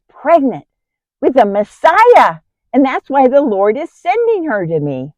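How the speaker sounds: high, loud, thrilled proclamation; joyful excitement. Acted. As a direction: share glorious news with rising pitch and volume. Joyful news of the Messiah.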